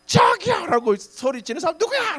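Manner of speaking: high, loud confronting yell; 소리 지르기. Pretend. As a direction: act out storming in to find who yelled. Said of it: "막 소리 지르고 싶었어요". (높고 크게 따지는 고함)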